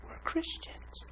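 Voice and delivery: put-on high, whispered girlfriend voice; imitated hushed reminder. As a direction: whisper it earnestly, imitating her. Retells her earnest, hushed reminder.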